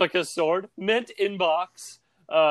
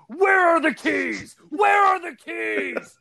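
high, barking shout like a drill sergeant; 14 semitones higher than usual. Pretend. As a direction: bark it out like a tiny drill sergeant. Kid Batman shouting at dad.

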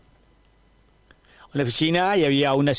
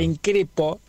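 ordinary talk, nothing marked

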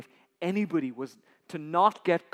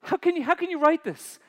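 ordinary talk

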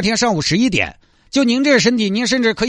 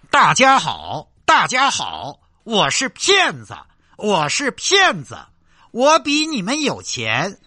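slow sing-song loudspeaker hawker chant; mocking recorded pitch for a fake beggar; street vendor recording; slower than usual. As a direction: drone it out like a looped market loudspeaker ad. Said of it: "录一个那种卖吼吼那种音频".

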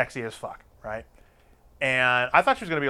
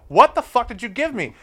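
alarmed shout; 9 semitones higher, 5 dB louder than usual. Retold or imitated. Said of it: "I'm like".

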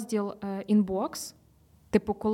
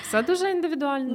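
laughing; 5 semitones higher, 5 dB louder, faster than usual. Real, not acted. Laughing while conceding.

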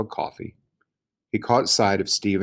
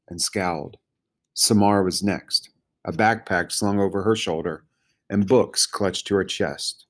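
usual speaking voice

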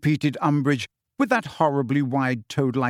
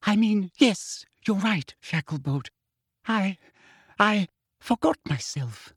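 breathless; 6 semitones higher than usual. Acted. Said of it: "said Umbridge breathlessly".

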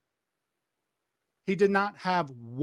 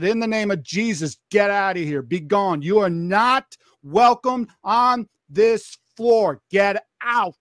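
loud, emphatic casting-out prayer; forceful exorcism command, hammering each word; commanding rebuke; 5 dB louder than usual. Retold or imitated. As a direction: re-enact ordering a spirit out. Re-enacts prayer casting out a spirit.

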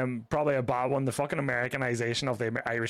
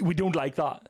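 usual speaking voice

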